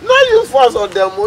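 high, urgent plea; anxious pleading. Acted. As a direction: beg him with pitch jumping up. Begging friend to spare in-laws.

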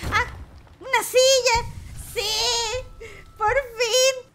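loud, high shouts of relief; excited cry. Real, not acted. Gamer yelling with joy at finding a chair. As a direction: cheer like you've waited forever.

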